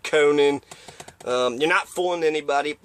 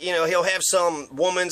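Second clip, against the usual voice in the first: ordinary talk, nothing marked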